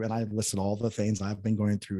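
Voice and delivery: ordinary talk, nothing marked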